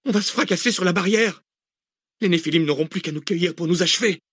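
high, urgent warning; panicked outcry. A narrator's reading. Researcher warning of crashing into the barrier, panicked. Panicked passenger before closed gate.

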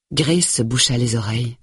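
ordinary delivery, nothing marked

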